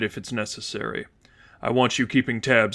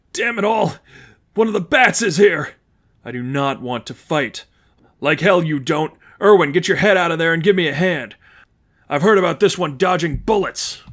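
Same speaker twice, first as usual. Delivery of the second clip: higher, louder alarmed thug; a thug's startled, raised cry; panicked shout; 5 semitones higher, 4 dB louder than usual. Acted. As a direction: yell a warning to your buddy.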